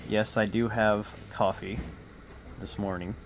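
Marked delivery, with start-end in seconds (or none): none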